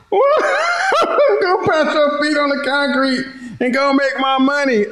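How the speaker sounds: high, slow words broken by laughter; can't hold the laugh while quoting; laughing through it. Real, not acted. Repeats line laughing.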